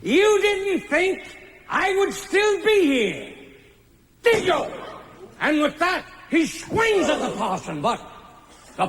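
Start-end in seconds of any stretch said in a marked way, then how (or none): 0.0-4.7 gloating stage villain, slow and high; Egbert. Acted. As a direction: sneer it out after a villain's cackle. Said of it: "Egbert would say".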